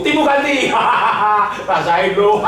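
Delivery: loud mocking jeer, high; triumphant taunt, loud and high; gleeful revenge shout. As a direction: shout it like a spiteful win. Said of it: "Kalau Tuhan Kristen ditipu".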